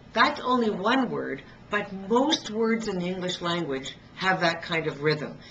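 usual speaking voice

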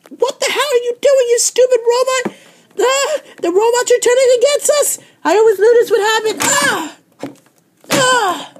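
high, loud, frantic screams at full force; hysterical screaming. Acted. Champion shrieks in a panic as her belt is taken. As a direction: shriek like a tantrum that won't stop.

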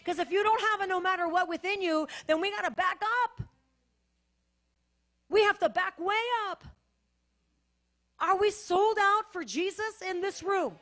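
rally the room like a revival preacher; preaching at full force. Acted. Pastor rousing the congregation to be sold out, loud fervent preaching.

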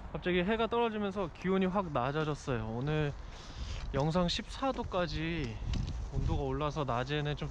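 muffled behind mask; speaking through face mask. Real, not acted. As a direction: mask over mouth.

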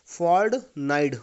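slow, loud फॉर्टनाइट with D sounds; फॉर्टनाइट said as "fardnaid"; German-style फॉर्टनाइट; German pronunciation. Shown as a demonstration. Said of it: "क्या लिखा है?".

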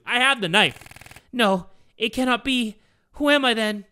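a touch of panic, hammed up; acted distress. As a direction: protest in mock anguish. Mia reacts to revelation.

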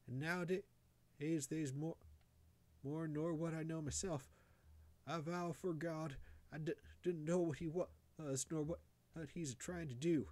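high-pitched, afraid country woman; anxious high voice, stumbling over the words; fearful complaining mother. A narrator's reading. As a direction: confide fearfully in high rustic dialect. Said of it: "poor creature complained to Mamie Bishop of being afraid".

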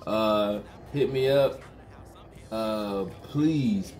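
ordinary talk, nothing marked

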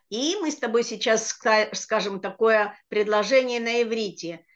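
ordinary talk, nothing marked

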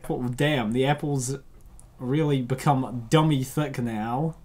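ordinary talk, nothing marked

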